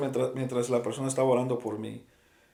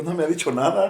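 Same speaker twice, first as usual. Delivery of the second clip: laughing; 4 semitones higher, 5 dB louder than usual. Real, not acted. Laughing while telling the story.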